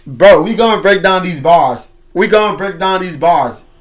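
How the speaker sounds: loud, emphatic declaration; hyped shout. Real, not acted. Telling viewers we'll break down these bars, hyped shout. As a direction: shout it out with force, twice.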